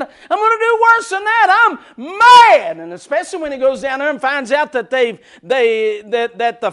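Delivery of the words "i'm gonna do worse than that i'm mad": high, loud furious threat; mock furious Samson. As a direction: rage at the Philistines. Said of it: "He said".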